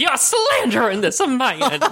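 high, slow, hammed-up outrage; Lindsey Graham. Politician accusing his critics of slander, hammed-up indignation. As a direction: draw out a politician's mock indignation through laughter. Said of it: "I hate Lindsey Graham".

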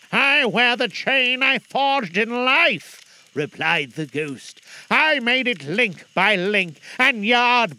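high, loud, slow ghost voice; unearthly voice. Acted. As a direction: drag each word like a chain.

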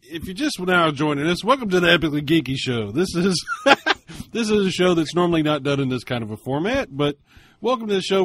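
loud, upbeat host intro; show host welcome. Acted. Host greeting listeners joining the show, announcer voice.

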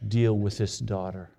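low, hushed pleading prayer to God. Retold or imitated. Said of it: "I've been praying all this week".

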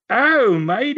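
high-pitched mock Australian, stretched "Oh, mate"; imitating an Aussie shower attendant, Australian accent. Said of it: "the Aussie, and he goes".

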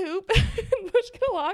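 high, loud words through laughter; laughing. Real, not acted. Telling friend about husband's teasing, laughing. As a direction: giggle the words out.

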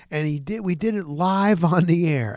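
normal talking voice

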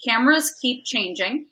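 ordinary delivery

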